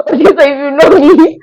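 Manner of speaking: laughing and half-crying at the memory; gasp into laughter. Real, not acted. Reliving the panic.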